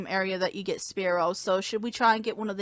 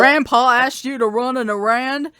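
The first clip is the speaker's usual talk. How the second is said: slow, loud put-on manly voice; 8 dB louder, slower than usual. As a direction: act like a big manly guy. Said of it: "I was just trying to do the manly voice".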